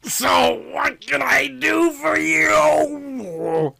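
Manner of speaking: drawn-out detective question; Andrew's voice. A narrator's reading. Detective struggling to focus on his visitor. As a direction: stretch it slow, fighting distraction.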